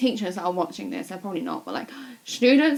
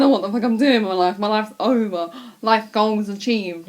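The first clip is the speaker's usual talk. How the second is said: re-enacted hysterics; 4 dB louder than usual. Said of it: "I burst out crying".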